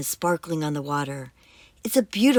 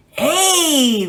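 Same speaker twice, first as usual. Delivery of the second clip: booming; 8 semitones higher, 5 dB louder than usual. Acted. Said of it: "booms the monster".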